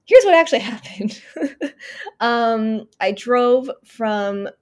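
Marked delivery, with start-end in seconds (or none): 0.0-1.2 laughing through the reveal. Real, not acted. Laughing as she reveals what really happened on the trip, laughing. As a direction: laugh as you say it, higher and louder.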